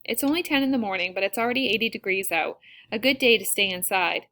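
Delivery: ordinary delivery, nothing marked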